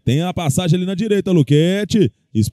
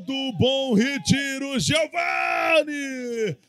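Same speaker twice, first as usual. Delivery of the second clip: explosive goal commentary; 6 semitones higher, slower than usual. Real, not acted. Goal scream for Bom Retiro.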